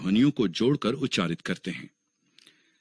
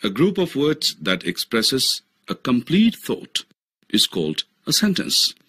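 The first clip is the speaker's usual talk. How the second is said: slow definition; 4 semitones higher, much slower than usual. Shown as a demonstration. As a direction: read out very slowly, word by word. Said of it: "sentence यानी वाक्य कहते हैं". (धीमे-धीमे परिभाषा पढ़ना)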